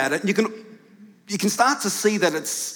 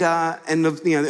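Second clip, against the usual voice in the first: ordinary talk, nothing marked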